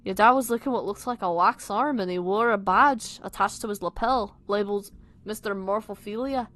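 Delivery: wandering, amateur Scouse; all-over-the-place accent. Acted. Friend describing dad's photo, wandering Liverpool accent. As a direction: Liverpool accent that keeps slipping. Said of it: "an all over the place".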